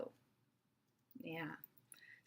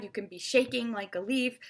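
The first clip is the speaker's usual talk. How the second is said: usual speaking voice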